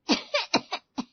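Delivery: sharp, loud cough; coughing fit. Acted. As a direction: cough from chilli in hot oil. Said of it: "खांसी शुरू हो गई".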